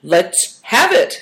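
curt. A narrator's reading. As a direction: demand it curtly, without hurrying. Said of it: "said Good Fortune curtly".

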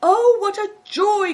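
very high, loud burst of delight; joyful cry. A narrator's reading. Innkeeper spotting Greencoat, overjoyed cry. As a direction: cry out in gushing welcome. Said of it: "cried the innkeeper".

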